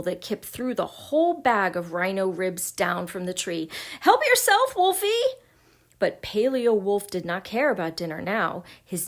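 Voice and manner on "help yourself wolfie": high, loud cry; frightened call. A narrator's reading. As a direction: call out in a squeaky panic. Kip frightened, throws bag to wolf.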